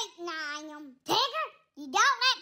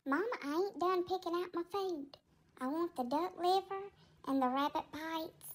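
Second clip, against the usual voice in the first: timid cat Tigger; 2 semitones lower than usual. Acted. Tigger picks food.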